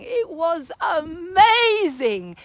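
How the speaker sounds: slow, choked-up amazement; near tears. Real, not acted. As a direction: let it catch in the throat. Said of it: "repeated with feeling".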